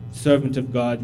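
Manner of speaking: ordinary talk, nothing marked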